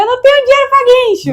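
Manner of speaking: high, loud desperate yell; high yelled plea, half-panicked; retold shout. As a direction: shout over the rain to the cop. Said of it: "É, eu falei".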